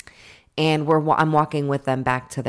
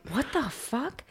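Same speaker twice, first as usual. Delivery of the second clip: shocked; 10 semitones higher, 8 dB quieter, slower than usual. Retold or imitated. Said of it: "Amanda's like".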